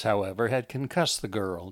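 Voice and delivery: plain reading voice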